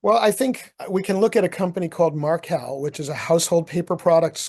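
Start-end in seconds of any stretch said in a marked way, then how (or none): none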